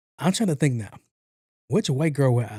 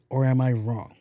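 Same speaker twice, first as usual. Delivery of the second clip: normal talking voice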